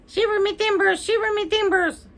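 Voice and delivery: very high, squeaky screech; screeching parrot. A narrator's reading. Unseen parrot calling from pet shop, high screech. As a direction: squawk it like a pirate's parrot. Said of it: "said the voice Screech".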